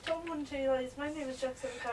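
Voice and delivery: feed the line quietly in Irish; murmured mock-Irish greeting; Irish accent. Shown as a demonstration. Feeds Irish impression line softly.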